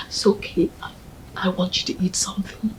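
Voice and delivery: quick, gentle whisper. Acted. Friend urging the mourner to eat, gentle whisper. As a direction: coax her softly to eat.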